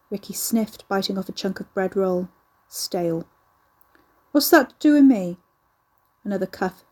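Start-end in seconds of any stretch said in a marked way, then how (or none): 4.3-5.4 sharp, raised and defiant; angry retort. A narrator's reading. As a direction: hurl the question back at her. Ricky snaps at gran.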